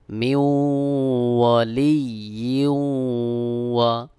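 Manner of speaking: ওয়ালিয়্যু chanted long, nasal glide into ওয়া; idgham with ghunnah. Shown as a demonstration. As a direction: hold the merged w of ওয়ালিয়্যু as a hum. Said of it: "দুই জেরের থেকে এক জের উচ্চারণ করে ওয়াওকে যুক্ত করতে হবে".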